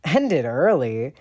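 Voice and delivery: slow, loud incredulous echo; demanding. A narrator's reading. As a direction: throw the excuse back. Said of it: "Sirius demands".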